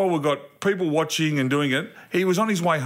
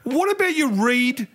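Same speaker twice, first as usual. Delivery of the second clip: retold exasperation; 5 semitones higher than usual. Dad telling son to read the pump, exasperated. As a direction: spell out the obvious to your son, high-pitched.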